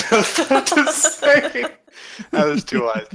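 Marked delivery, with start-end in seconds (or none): 0.1-1.6 high-pitched laughing agreement. Real, not acted. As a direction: talk through laughter. Laughing at the same thought.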